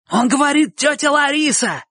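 very high pleading cry; desperately hopeful shout. A narrator's reading. Bocho repeats Chik's answer to boys, desperate hope. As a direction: shout it to the gang for rescue. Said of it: "крикнул Бочо с отчаянной надеждой".

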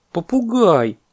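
high, drawn exclamation; protesting monkey. Acted. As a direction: call out in high-pitched protest.

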